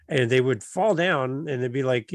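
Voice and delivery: usual speaking voice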